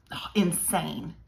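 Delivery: usual speaking voice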